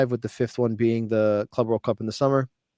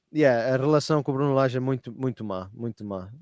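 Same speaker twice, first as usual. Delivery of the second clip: sarcastic; 5 dB quieter than usual. Retold or imitated. Host imitating the coach's answer to a reporter, sarcastic. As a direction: trail down into quiet, dry sarcasm. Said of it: "Like, sarcastically".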